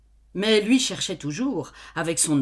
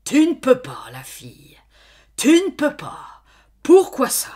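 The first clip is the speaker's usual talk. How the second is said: farmer's angry shout, much higher and louder; flaring anger; 8 semitones higher, 10 dB louder than usual. Acted. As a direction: blow up at the girl. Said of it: "Mais il s'emporta tout à coup".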